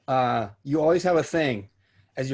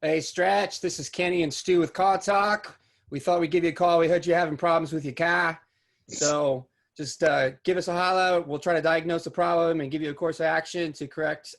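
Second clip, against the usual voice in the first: upbeat voicemail in a put-on Northeast accent, pitched higher; mock Northeast accent; 4 semitones higher than usual. Leaving friend Stretch a voicemail, hammed-up Northeast accent. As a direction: lay on a Northeast accent for a friend from back east. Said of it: "Dude, that was a pretty good accent".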